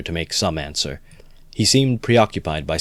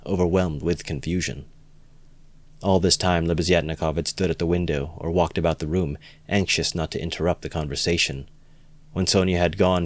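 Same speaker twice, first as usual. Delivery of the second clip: usual speaking voice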